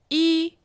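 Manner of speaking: E held high and level; first tone. Shown as a demonstration. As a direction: keep E flat and high. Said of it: "the four tones".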